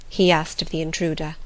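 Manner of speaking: ordinary delivery, nothing marked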